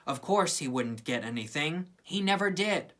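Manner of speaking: plain reading voice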